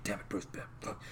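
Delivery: low, hushed, despondent cursing; grieving drinker. Retold or imitated. Aquaman drinking over dead Bruce, low despondent mumbling. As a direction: curse under the breath, nearly voiceless.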